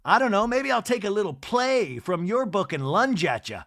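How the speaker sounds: hammed-up housewife threat, stretched out; Angie. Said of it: "And then Angie goes".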